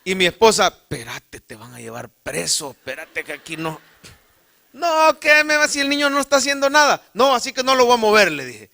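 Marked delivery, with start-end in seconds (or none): 0.7-3.8 hushed urgent plea. Retold or imitated. Wife urging him to stop. 4.7-8.3 much higher and louder, defiant; heated shout. Retold or imitated. Telling the guard he won't move the boy, loud defiant outburst.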